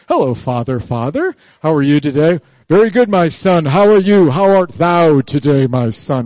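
loud, hammed-up formal English accent; mock English accent. Formal father and son greeting, hammed-up English accent. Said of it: "in an English accent".

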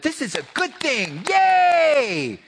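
sarcastic high, loud little cheer on yay; hammed-up celebration to mock the idea. Preacher mocking a cheer at Lazarus' death, mock yay.

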